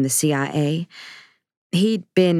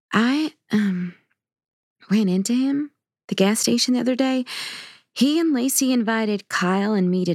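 put-on higher, hesitant teenage girl voice; teen daughter Kaylee; 5 semitones higher than usual. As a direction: play the guilty daughter confessing.

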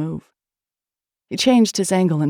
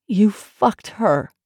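slow, higher-pitched accusing line; bitter accusation; 4 semitones higher, 4 dB louder, much slower than usual. A narrator's reading. Woman accuses her lover of cheating, bitter. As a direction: drag out each word in accusation.

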